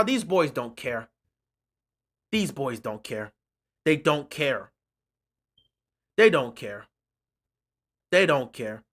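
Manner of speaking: low, quiet, resigned; dejected. Real, not acted. Fan resigned that the players don't care.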